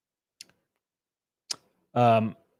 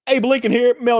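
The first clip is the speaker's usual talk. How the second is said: excited; 10 semitones higher, 6 dB louder than usual. Real, not acted. Jokey exclaim to chat.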